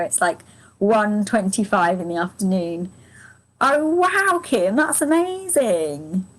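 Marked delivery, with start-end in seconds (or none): 3.6-6.2 higher on the wow, then slow and drawn out; genuinely thrilled at the news; real delight at a viewer's comment; delighted surprise. Real, not acted. Reads a viewer's happy news.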